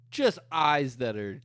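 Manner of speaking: ordinary talk, nothing marked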